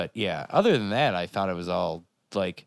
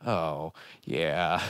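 low, slower, sinking oh yeah; mock deflated recognition; 5 semitones lower, 3 dB quieter than usual. Host reacting to dated jokes that now seem sad, mock deflated. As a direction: let it sag as the joke turns sad. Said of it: "less funny and more sad".

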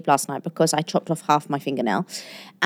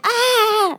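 high mock cry; imitated toddler wail. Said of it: "And then he went".

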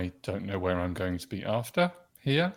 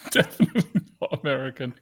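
laughing; 4 semitones higher, 5 dB louder, much faster than usual. Real, not acted. Laughing off own accent skills.

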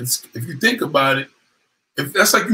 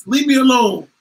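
annoyed brush-off; 9 semitones higher, 5 dB louder than usual. Retold or imitated. Woman fending off dude.

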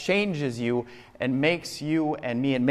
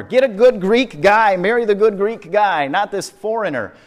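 higher, much louder overbearing Greek father; Greek dad; 9 semitones higher, 10 dB louder than usual. Retold or imitated. Pastor mimics the Greek Wedding dad forbidding a foreign groom, loud and high. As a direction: bellow it like the worried Greek dad. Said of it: "what is the dad concerned about?".

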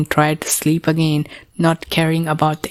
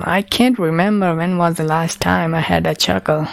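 ordinary delivery

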